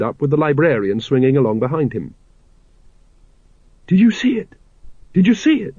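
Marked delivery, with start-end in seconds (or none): 3.9-5.7 put-on high, eager young voice; excited Carrot. A narrator's reading. Young constable bursting in to his captain, excited. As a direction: burst in thrilled, pitched up. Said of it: "he said".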